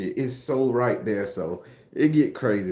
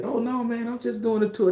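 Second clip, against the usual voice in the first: much higher, meek and polite; soft tourist voice; 11 semitones higher than usual. Retold or imitated. Mocking the weak answer that gets you robbed, meek high voice. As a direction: play the timid nice guy who gets robbed. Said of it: "they won't respect you if you say".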